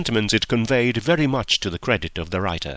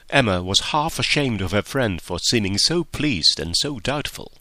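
usual speaking voice

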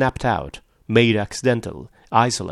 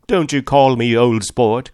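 loud, angry warning; angry cry; 3 semitones higher, 7 dB louder than usual. A narrator's reading. Tom objects to Gatsby's nickname for him, crying angrily. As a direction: snap it out loudly. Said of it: "cried Tom".

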